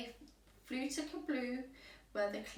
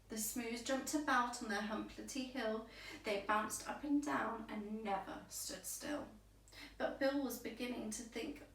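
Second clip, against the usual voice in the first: ordinary delivery, nothing marked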